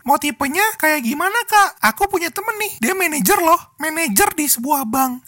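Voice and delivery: put-on high sugary girlfriend voice; high, sweet doting tone; cute girl voice.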